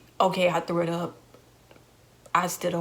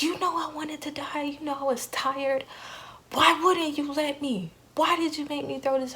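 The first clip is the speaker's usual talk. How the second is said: angry plea to God; 8 semitones higher than usual. Retold or imitated. To God in the hospital, a higher angry lament. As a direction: rail at God in despair. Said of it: "I'm mad at God".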